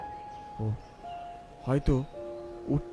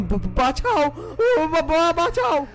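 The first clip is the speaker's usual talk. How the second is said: high stammering cry for help; screaming for help; 9 semitones higher, slower than usual. Acted. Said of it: "আর্তনাদ করে উঠলাম".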